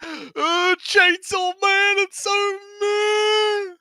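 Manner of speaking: high, slow, drawn-out whine; mock whine. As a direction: whine it like a bored critic. Mock whiny verdict.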